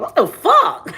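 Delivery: high startled squeak; shocked, pitch flying up; sudden high what the fuck. Retold or imitated. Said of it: "I'm just like".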